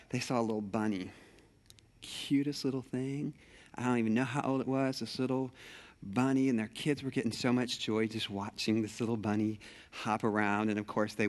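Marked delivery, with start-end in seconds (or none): none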